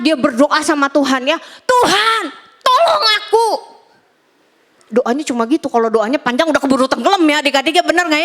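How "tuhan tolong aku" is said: panicked cry for help. Retold or imitated. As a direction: cry out like a sinking man. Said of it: "dia teriak".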